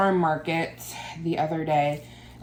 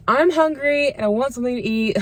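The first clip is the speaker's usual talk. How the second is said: excited; 7 semitones higher, 3 dB louder than usual. Real, not acted. Hungry after plasma donation.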